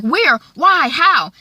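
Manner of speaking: loud, high startled questions; acted alarm. Cryer hears his son is in hospital, acted alarm. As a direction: fire out panicked one-word questions.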